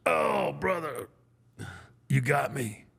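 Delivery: hammed-up hurt wrestler moaning to his opponent; voicing Hogan. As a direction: play the big man going down, drawn out. Quoting the big dude hit.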